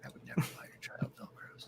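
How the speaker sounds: hushed unvoiced aside; whispering. Real, not acted. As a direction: whisper it as a secret joke. Joking aside to friend.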